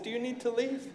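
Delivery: soft, hushed polite question; quiet demo of a polite question. Shown as a demonstration. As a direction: ask it quietly, almost hushed. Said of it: "I said about like that".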